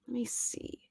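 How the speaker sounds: whisper. Real, not acted. Whispers to herself.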